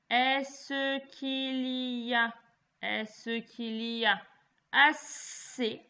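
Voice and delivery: slow dictation, words stretched and repeated; dictating. Shown as a demonstration. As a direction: dictate clearly with long pauses between words. Teacher dictates to pupils.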